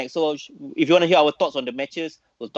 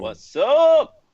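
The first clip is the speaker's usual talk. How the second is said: excited shout; 13 semitones higher, 7 dB louder than usual. Real, not acted. Greeting guest loudly.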